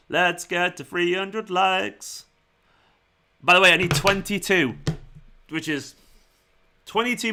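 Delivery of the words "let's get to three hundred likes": very slow, every word drawn out; stretched-out plea. Pretend. Streamer urging viewers towards three hundred likes, very drawn-out. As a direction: drag the words out like a slow chant.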